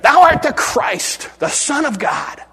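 loud, high, slow declaration; proclaimed confession. Retold or imitated. Peter confessing who Jesus is, loud high proclamation. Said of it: "When Peter made that confession".

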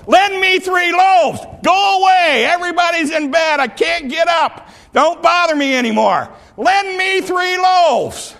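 both men shouting over each other; full shout. Acted. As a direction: yell it through the door, escalating. Knocker and sleeper shouting back.

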